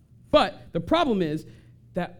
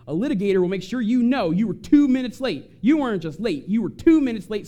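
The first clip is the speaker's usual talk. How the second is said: emphatic litigator; 6 semitones higher, 7 dB louder than usual. Retold or imitated. Mocking litigator's nitpicking.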